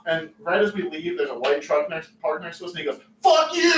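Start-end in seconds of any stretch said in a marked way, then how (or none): 3.2-3.7 put-on high, loud drunk shout; yelled out the window. Drunk friend yelling at a parked truck, loud and high. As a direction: bark it at the stranger, laughing. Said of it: "Out the window".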